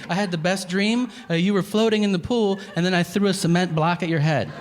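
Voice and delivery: mock wife. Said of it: "I go".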